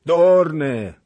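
angry shout. A narrator's reading. Witch snapping angrily at the Cossack. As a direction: bark it out furiously. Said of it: "zawołała z gniewem Horpyna".

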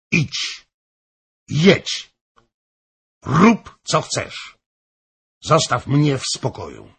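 loud, high, indignant commands; exasperated outburst in sharp, separate bursts; angry outcry. A narrator's reading. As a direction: fire off short orders, fed up. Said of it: "krzyknęła".

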